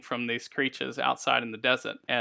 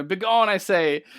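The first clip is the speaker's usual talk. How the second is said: slow, louder, higher indignant yell; angry yelling dwarf; 6 semitones higher, 6 dB louder, slower than usual. Acted. Dwarf yells at the slow-walking characters, furious. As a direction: drive them out of the cave with a shout. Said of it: "he gets even more angry and starts yelling".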